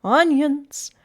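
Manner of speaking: loud, drawn-out hawker's cry; street vendor's call. A narrator's reading. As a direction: call your wares down the street. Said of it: "Sam called out on the street".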